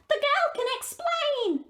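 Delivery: high, squawky bird voice with caws; a cheerful raven chattering; talking raven. Acted. Raven defends Gerda.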